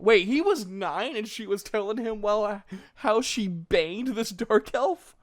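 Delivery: can't believe what she just read; disbelief. Real, not acted. Reader shocked by the story.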